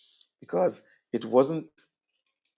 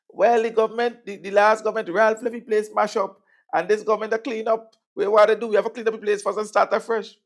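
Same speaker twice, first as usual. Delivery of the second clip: mimicking repeated talk; 10 semitones higher, 5 dB louder than usual. People repeating government line.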